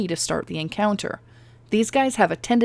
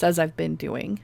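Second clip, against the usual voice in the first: normal talking voice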